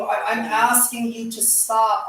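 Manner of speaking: normal talking voice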